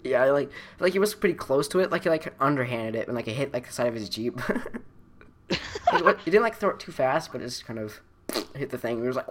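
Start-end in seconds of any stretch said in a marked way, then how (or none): none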